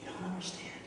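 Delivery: very soft, slow reproach; hushed, nearly whispered reprimand; quiet rebuke. Retold or imitated. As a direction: let the rebuke land in a near whisper. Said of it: "he rebukes Peter".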